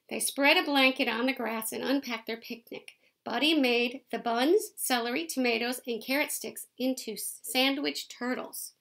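lively picture-book read-aloud; reading to children. A narrator's reading. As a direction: read it out to the kids, bright and clear.

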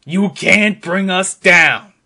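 ordinary talk, nothing marked

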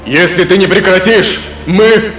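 loud, high furious warning; angry threat. Acted. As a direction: threaten him, cut off mid-word. Elf prince threatens Hades.

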